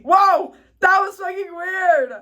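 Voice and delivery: sudden loud, high whoa, real shock; startled outburst. Real, not acted. Vlogger startled by her mom's text, loud real surprise. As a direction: yelp in genuine surprise. Mom texts mid-video.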